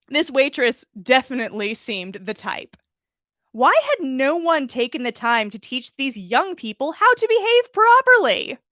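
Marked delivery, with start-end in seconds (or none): 3.5-8.6 rising outraged huff, much higher at the end; indignant huff. Acted. Umbridge fuming at rude young people, rising indignation. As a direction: let the indignation climb to a squeak.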